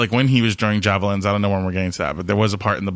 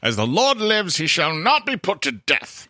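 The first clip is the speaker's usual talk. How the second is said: mock British accent, high and slow; a hammed-up British king's oath; 7 semitones higher, slower than usual. Saul swearing an oath to Jonathan, mock British accent. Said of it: "I don't know why he's British".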